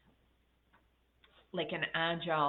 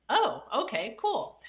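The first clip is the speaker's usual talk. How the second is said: excited; 8 semitones higher, 6 dB louder than usual. Retold or imitated. Retold pleased reply to offer.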